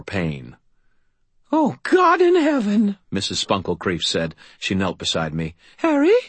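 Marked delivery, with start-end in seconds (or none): 1.5-3.0 put-on high, slow old woman's voice; a shocked old lady, drawn out; old landlady. Landlady kneels by fallen Harry, high and slow. 5.8-6.2 put-on high, loud worried old woman; anxious old lady asking; old landlady. Landlady checks on Harry, high and worried.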